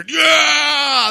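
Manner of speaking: loud high cheering yeah; victory shout. Acted. Pastor cheering the church's victory verse, loud shout. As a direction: shout it like a win.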